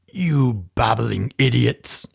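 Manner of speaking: slow, loud furious shout at the henchmen; violent yell. A narrator's reading. Russian boss yelling at his bungling men, furious. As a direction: bellow it at the bumbling idiots. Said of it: "yelled one of the men violently".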